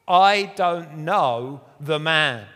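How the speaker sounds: loud, slow, each word pushed out; sworn denial. Retold or imitated. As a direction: swear it loudly and slowly, like an oath. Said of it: "This time he takes an oath".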